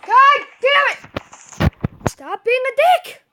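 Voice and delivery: high-pitched, drawn-out exasperated cursing; pissed off. Real, not acted. Player cussing out an opponent, pissed-off high outburst. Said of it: "I'm getting pissed off now".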